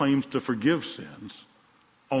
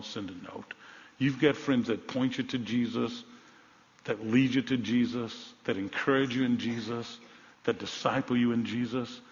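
normal talking voice

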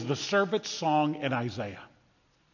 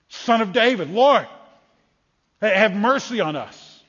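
loud, raised cry for mercy; beggar's higher, louder plea; calling out; 4 semitones higher, 5 dB louder than usual. Acted. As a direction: call out urgently to someone passing by. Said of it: "Bartimaeus is calling out".

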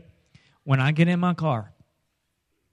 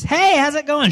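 cheery friend; 14 semitones higher, 9 dB louder than usual. Retold or imitated. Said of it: "your friend says".

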